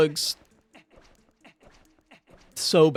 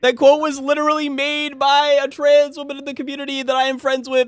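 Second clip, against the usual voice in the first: much higher, louder complaint; whiny protest; 8 semitones higher, 4 dB louder than usual. Real, not acted. Complaining to chat, high whiny protest. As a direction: whine that it's not fair.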